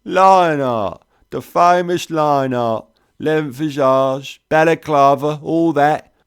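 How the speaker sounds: thick London cockney, pitched high and loud; a jolly, drawling cockney mate; McTavish cockney. Acted. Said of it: "cockney thick as fog on the Thames".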